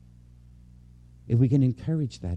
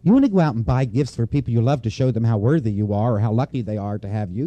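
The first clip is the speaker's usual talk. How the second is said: ordinary talk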